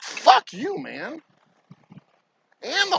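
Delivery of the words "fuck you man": high, spat-out curse; outraged curse. Real, not acted. Cursing the rude customer to viewers, high contemptuous curse. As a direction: fling it at them with contempt.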